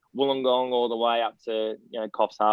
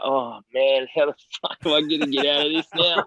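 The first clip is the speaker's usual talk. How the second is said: laughing disbelief; 3 semitones higher than usual. Retold or imitated. Former player retelling his dread to the interviewer, laughing. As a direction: laugh through the mock dread of being stuck. Retold panic at being taken seriously.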